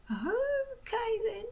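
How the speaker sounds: calm him down gently; soothing. A narrator's reading. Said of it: "soothed old Wrinkly".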